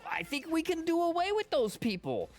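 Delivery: high, loud ranting comic impression; Orny Adams rant. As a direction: yell it like a fed-up comic on stage. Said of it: "It's like".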